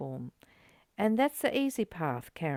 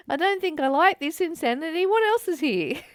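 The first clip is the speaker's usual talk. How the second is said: higher, louder and fast, half laughing; mock inner voice; 7 semitones higher, 6 dB louder than usual. Friend acting out rejecting insanity, mock bright and fast. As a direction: play the mind fed up and looking elsewhere. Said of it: "it's kind of saying".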